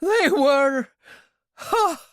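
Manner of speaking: high, loud panicked telling; desperate pleading. Acted. Royale convincing Talbot, high and loud in desperation. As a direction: pour it out to save his life.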